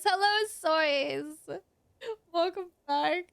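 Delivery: slow greeting broken up by laughter; trying to greet while cracking up; laughing through greeting. Real, not acted. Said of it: "I'm crying".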